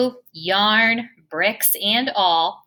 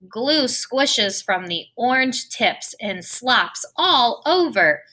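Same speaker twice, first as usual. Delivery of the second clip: usual speaking voice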